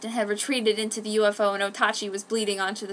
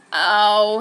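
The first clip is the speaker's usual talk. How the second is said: loud pained ow; groan; 10 dB louder than usual. A narrator's reading. Wounded boy groans when his face hurts. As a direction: groan out in pain. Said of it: "he groaned".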